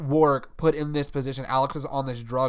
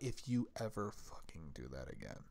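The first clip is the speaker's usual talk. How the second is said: much lower, much softer threatening growl; low menacing threat; 9 semitones lower, 16 dB quieter than usual. Retold or imitated. Podcaster imitating Warwick's sudden angry threat. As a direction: drop the voice into a quiet threat. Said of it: "when he gets pissed".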